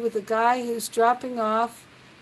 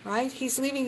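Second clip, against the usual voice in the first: ordinary talk, nothing marked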